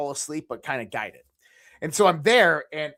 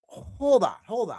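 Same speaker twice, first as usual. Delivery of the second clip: startled hold on; 7 semitones higher than usual. Retold or imitated. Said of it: "And I go".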